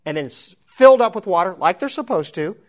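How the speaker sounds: ordinary talk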